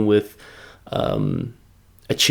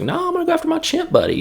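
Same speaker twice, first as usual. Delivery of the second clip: much higher, fast hammed-up hero line; mock Mark Wahlberg; much faster than usual. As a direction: blurt it out in a silly high hero voice. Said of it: "And Mark Wahlberg was like".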